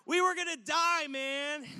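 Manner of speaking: loud, high mock-desperate yell; hammed-up shout of protest; exclaimed outburst. Acted outburst to the rescuer.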